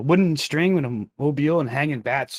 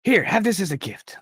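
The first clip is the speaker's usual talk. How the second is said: toymaker voice; 7 semitones higher than usual. Acted. Toymaker offers dummy.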